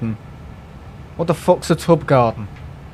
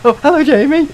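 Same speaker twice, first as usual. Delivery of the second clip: mock greeting; 12 semitones higher, 7 dB louder than usual. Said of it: "me and my dad and Martin are like".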